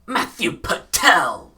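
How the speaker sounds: loud, high, slow announced name; Matthew Patel impression. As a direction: shout the name like a showy villain. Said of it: "That's what he says".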